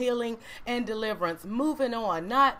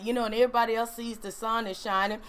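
ordinary talk

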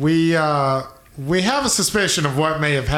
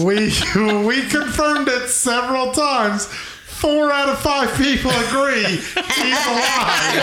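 high, laughing insistence; high squeaky voice, barely holding laughter; gnome character voice; 8 semitones higher than usual. Acted.

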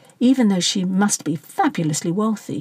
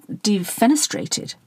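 ordinary delivery, nothing marked

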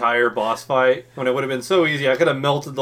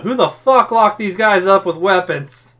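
higher, louder exasperated question; incredulous outburst; 7 semitones higher, 5 dB louder than usual. Real, not acted. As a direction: demand an answer to something absurd. Mock outrage at prison's absurdity.

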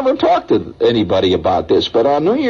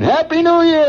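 imitating a cheering crowd; mock radio cheer. Radio crowd shouting, retold.

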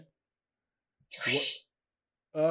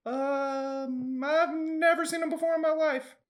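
nervous liar; 11 semitones higher than usual. Acted. GM voicing lying goblin.

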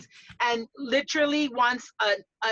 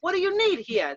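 put-on a lightly higher New York accent with panache; 4 semitones higher than usual. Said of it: "that New York panache".

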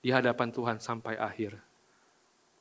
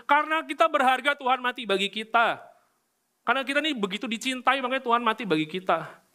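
overconfident boast; 9 semitones higher than usual. Retold or imitated. Preacher mocks believers boasting they are worth dying for, smug and raised. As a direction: puff up with self-importance, voice up high. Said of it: "kita tuh kok kepedean gitu".

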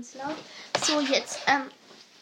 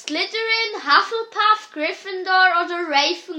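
much higher and louder, slow; loud, slow calling; 5 semitones higher, 11 dB louder than usual. Real, not acted. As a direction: call out each house name. Shouting the question over music.

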